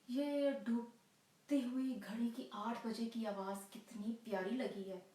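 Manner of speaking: theatrical lover, hopeful outbursts between uneasy pauses; anxious waiting lover. Acted. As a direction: play a lover talking himself into hope.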